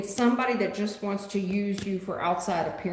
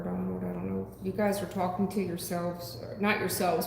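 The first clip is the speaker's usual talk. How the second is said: ordinary delivery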